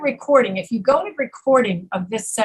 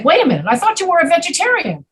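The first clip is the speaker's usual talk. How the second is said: high, quick mock disbelief; fast, higher-pitched comic objection; surprised challenge; 7 semitones higher, faster than usual. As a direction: catch them out in acted surprise. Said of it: "You say".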